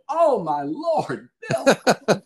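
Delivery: overwhelmed by the compliment, breaking into a laugh; moved exclamation. Retold or imitated. Retelling her reply to Bill's compliment.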